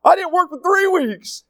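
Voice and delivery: high mock teen voice, slow and smug; mocking a lazy kid. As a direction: ham up a carefree teen bragging about not working. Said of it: "Today he's like".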